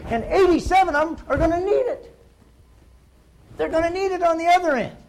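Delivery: high, loud, emphatic pitch; impassioned urging. Real, not acted. Trainer urging agents to sell insurance that lasts, loud and impassioned. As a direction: drive it home with rising force.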